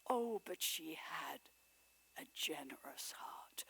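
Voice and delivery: soft whisper, barely voiced; whispered memory. A narrator's reading. Scrooge remembers his sister's heart, whispering. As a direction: breathe it out tenderly.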